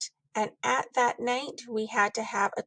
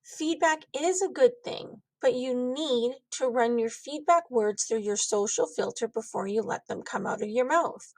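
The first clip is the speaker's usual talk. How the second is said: plain reading voice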